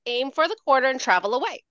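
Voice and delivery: normal talking voice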